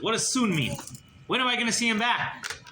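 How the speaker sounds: retold high-pitched anxious questioning; impatient demand. A narrator's reading.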